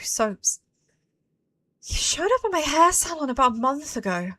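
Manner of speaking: soft, subdued recollection; hushed character narration; captive woman remembering. Acted. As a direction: recount it quietly, piece by piece.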